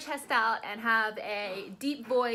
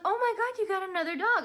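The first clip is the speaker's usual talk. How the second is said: much higher, louder squeal of delight; neighbor's gushing surprise; 10 semitones higher, 5 dB louder than usual. Retold or imitated. Neighbor spotting the new dog, high excited gasp. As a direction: squeal it like you can't believe there's another dog. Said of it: "she's like".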